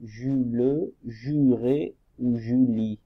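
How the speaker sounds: "Jules, Juré ou Julie" slow, each syllable drawn out; sounding out syllables. Shown as a demonstration. Teacher asks pupils which word is right, slow syllable blending. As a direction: stretch "Ju" before each ending, "Jules" with a sounded final e. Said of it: "On dit quoi?".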